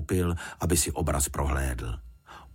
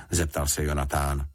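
usual speaking voice

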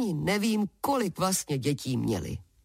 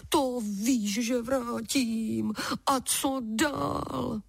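higher, drawn-out giant's promise; eager giant, slow and raised; promising giant; 6 semitones higher, much slower than usual. A narrator's reading. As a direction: promise readily, stretching the last word. Giant promises the same again.

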